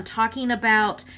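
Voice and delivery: ordinary talk, nothing marked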